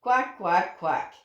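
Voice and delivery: lightly voiced quacks; a hint of a friendly duck. A narrator's reading. Yellow duck greets the owl with light quacks. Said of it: "said hello with a quack".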